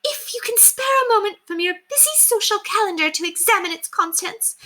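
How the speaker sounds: sarcastic, menacing mistress scolding the maid; dangerously controlled. Acted. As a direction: keep the fury on a tight leash. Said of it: "voice was dangerously controlled".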